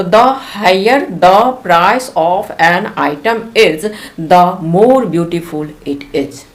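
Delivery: each word laid out slowly for the class; slow example read. Shown as a demonstration. Teacher reading the example to her students, slow and clear. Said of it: "teacher reads example sentence".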